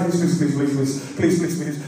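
low, hushed repeated begging; mock terror on a loop; terrified pleading. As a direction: babble it as if frozen with fear. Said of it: "you were just terrified".

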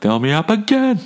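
whiny high-pitched Fill me up again, pushing; demanding refill. Retold or imitated. Customer demanding a refill from the waiter, high and whiny. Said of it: "I'm like".

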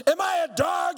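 a mock roar at a boy. Giant roaring at David, mock roar. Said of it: "he roared at David".

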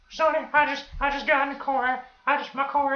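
high-pitched put-on old lady. Old lady telling the cashier about her car, high put-on voice. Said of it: "I'm not gonna talk like that".

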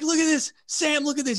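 high-pitched imitation of a panicking buddy; retold freak-out. Wounded gunner calls to the interpreter, freaking out. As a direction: act out his alarm, voice pushed high. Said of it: "He starts freaking out".

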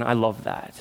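ordinary delivery

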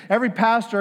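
ordinary delivery

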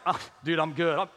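low, choked-up groom holding it together; mock sobbing. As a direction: imitate a big guy trying not to cry. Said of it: "Just sobbing".

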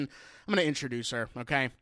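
ordinary talk

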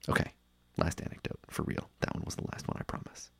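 whispered aside, not to be trusted; sheepish whisper. Real, not acted. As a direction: drop to a near whisper, apologetic. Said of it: "sheepish aside".

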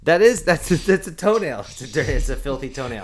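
loud indignant outburst. Real, not acted. Reacting to Chargers logo.

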